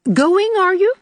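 high, loud challenge; affronted old aunt. Acted. Old aunt as the girls rise to go, affronted. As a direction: call them out for leaving. Voices disagreeable Aunt Atossa.

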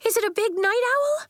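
put-on very high, eager child's voice; excited cry. A narrator's reading. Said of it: "cried Nori".

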